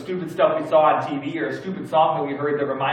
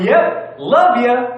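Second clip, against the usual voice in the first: high, loud, chirpy Southern goodbye; accidental Southern sign-off; 7 semitones higher, 8 dB louder, much slower than usual. Retold or imitated. Caller signing off to cabin lady, exaggerated Southern chirp. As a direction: blurt it in a borrowed twang. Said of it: "I go".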